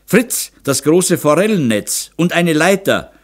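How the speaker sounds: high, loud order; brisk command. A narrator's reading. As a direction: send a servant off with an order. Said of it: "befahl Kitty". (lauter, hoher Befehlston)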